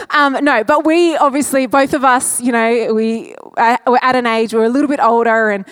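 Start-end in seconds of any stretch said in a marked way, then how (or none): none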